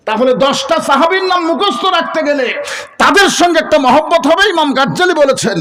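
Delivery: high, ringing preacher's delivery; full-force preaching. Acted. Preacher telling the crowd about love for companions, full-force preaching.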